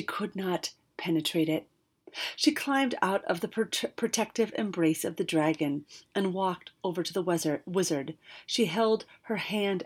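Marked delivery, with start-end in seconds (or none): none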